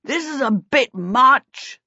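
high-pitched protesting call, drawn out; a king's slow, put-out call. A narrator's reading. King protesting as he chases the fleeing prince, calling out. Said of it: "the king called out".

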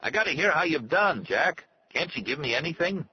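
high-pitched, pushy complaint; exasperated, demanding character voice; yelling demand. A narrator's reading. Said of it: "Arnie yelled".

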